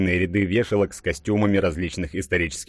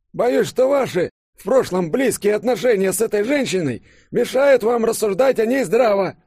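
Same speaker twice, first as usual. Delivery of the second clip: put-on very high, louder cranky old-man voice; querulous old man; 5 dB louder than usual. A narrator's reading. Old professor under the table scolding Konstantin, querulous. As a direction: scold like a grumpy elder, pitched way up. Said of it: "ворчливый старческий голос".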